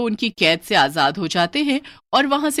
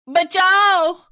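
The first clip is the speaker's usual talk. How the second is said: much higher, louder scream for help; terrified cry for help; 11 semitones higher, 7 dB louder, much slower than usual. Acted. Family crying for help as the ghosts surround them, terrified.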